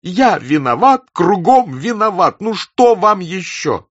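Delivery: high, loud, slow anguished cry; desperate, drawn-out high wail of words; tearful outburst. A narrator's reading. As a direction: cry out the confession, near tears. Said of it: "Слёзы стояли у него в глазах".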